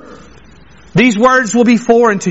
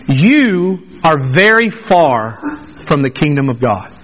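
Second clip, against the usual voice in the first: solemn verdict; 9 semitones lower than usual. Pretend. Voicing God's verdict.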